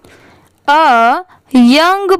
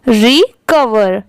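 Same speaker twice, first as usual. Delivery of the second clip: slow teacher model of "recover"; slow, careful "recover" for the class; slow model word; much slower than usual. Shown as a demonstration. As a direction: say "recover" slowly, syllable by syllable. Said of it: "दोनों मिला देंगे तो क्या हो जाएगा".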